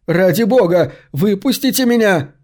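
high, urgent begging; pleading. A narrator's reading. Max, trapped in the coffin, begs for release, pleading. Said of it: "умолял он".

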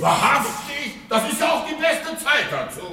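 high sarcastic jeer. Acted. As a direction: rub her nose in it. Husband mocks caught wife.

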